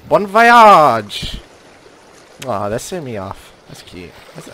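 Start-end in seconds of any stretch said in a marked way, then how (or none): 0.1-1.4 loud farewell. Acted. Sending someone off.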